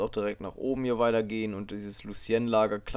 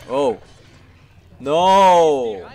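dramatic no; 4 semitones higher, 12 dB louder than usual. Real, not acted. Loss in game.

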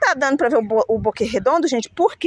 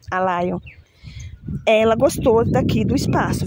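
ordinary talk

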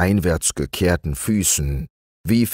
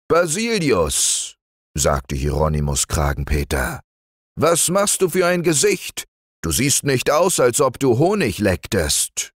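raised, fussy bear voice; Hieronymus the bear; 7 semitones higher than usual. Acted. Hieronymus stuck at the knothole, fussing to Basilius. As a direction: complain the hole is too narrow.

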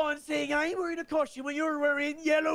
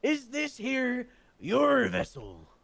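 slow, stilted game-character pirate line; slow NPC pirate voice; much slower than usual. Acted. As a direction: deliver it like a scripted NPC. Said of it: "He sounds like an N P C".

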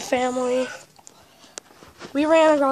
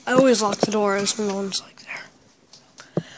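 secretive whisper; 4 semitones lower than usual. Real, not acted. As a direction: whisper it close to the mic. Hushed aside about locks.

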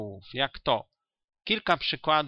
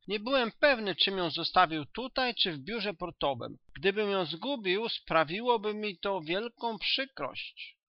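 put-on higher, earnest voice of a young doctor; eager young doctor; 7 semitones higher than usual. A narrator's reading. Young doctor explaining about his lost cane, eager and higher. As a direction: play him eager and a little anxious.

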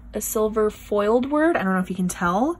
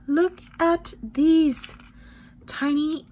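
higher, slow, drawn-out marvelling; awed excitement; 6 semitones higher, much slower than usual. Real, not acted. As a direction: stretch it out in delight. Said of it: "repeated with more awe".

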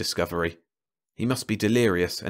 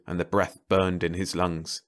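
ordinary delivery

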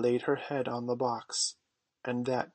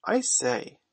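high, louder exclamation; boy calling out a discovery; excited cry; 7 semitones higher, 5 dB louder than usual. A narrator's reading. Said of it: "cried John".

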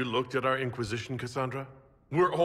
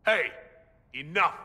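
loud, higher command to cut them off; sharp call to stop; 8 semitones higher, 4 dB louder than usual. Acted. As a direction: stop the fight cold. Breaking up the fight.

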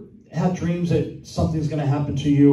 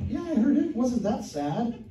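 higher, softer mock wife voice; imitating wife; 7 semitones higher, 6 dB quieter than usual. Wife teasing husband about the sad testimony, high and soft. Wife's reply in retold exchange.

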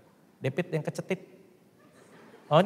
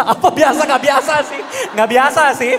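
incredulous; 8 semitones higher, 4 dB louder than usual. Real, not acted. Comic disbelieving.

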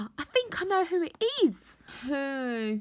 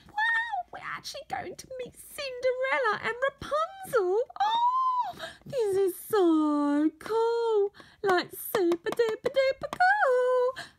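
excited squeal; 7 semitones higher than usual. Acted. Toy about to meet Cinderella, squealing with delight. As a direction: squeal with delight at meeting royalty.

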